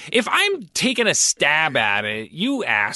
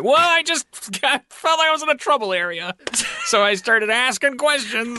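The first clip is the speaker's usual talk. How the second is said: flustered excuse; 8 semitones higher than usual. Retold or imitated. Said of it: "I'm like".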